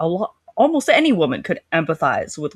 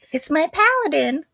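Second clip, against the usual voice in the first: much higher, dreamy and delighted; swooning Grace; 10 semitones higher than usual. Retold or imitated. Grace spotting her paladin at the door, high dreamy voice. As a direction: coo over him.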